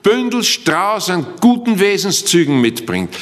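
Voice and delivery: impassioned sermon delivery; fervent preaching. Acted. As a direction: preach it out with full conviction. Preaching with raised voice.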